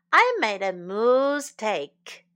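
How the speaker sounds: MOOSE drawn out slowly in place of "mis-"; moose take pun. A narrator's reading. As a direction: land on MOOSE, slow and loud, for the pun. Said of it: "把mistake换成了moose take".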